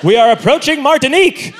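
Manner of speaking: high, exclaiming mock sightseer; cruise-ship passenger. Passenger leaning over a cruise ship's rail, mock elated. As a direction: call out grandly from the ship's rail. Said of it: "leaning over the edge of a cruise ship".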